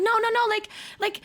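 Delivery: high, stammering protest; tripping over words, pleading your case; flustered protest. Retold or imitated. Acted protest after being refused.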